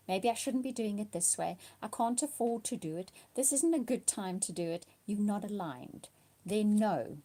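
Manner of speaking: normal talking voice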